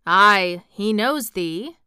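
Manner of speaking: put-on slow, drawn-out moor-boy speech; low voice. A narrator's reading. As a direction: say it slowly and weightily. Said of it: "said Dickon in his low voice again".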